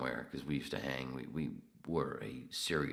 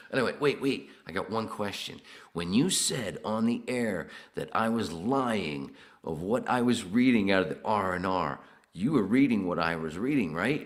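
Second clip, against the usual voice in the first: louder, higher eager questioning; excited retold question; 6 semitones higher, 10 dB louder than usual. Comedian quizzing the producer, loud and eager.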